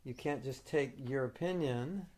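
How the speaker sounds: ordinary delivery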